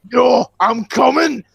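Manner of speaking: retold shout. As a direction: call it out loud, dragging the words. Boy shouting back in retold story.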